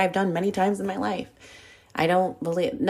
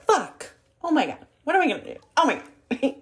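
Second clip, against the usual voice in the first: high, frantic burst of panic; retold panic; 8 semitones higher than usual. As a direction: freak out like everything just collapsed. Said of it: "And I was just like".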